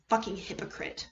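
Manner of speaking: a hissed, unvoiced curse under the breath; bitter whisper. Kaito cursing Kokichi under his breath, whispered. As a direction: whisper the insult to himself.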